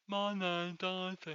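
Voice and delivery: slow lisping London voice. Retold or imitated. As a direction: imitate a lisp. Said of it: "like, a lisp or some fucking big tongue".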